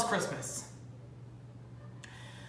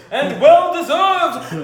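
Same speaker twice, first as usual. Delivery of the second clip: slow, loud retort; shouting; 5 semitones higher, 8 dB louder, slower than usual. Acted. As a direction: shout it back. Defending rudeness loudly.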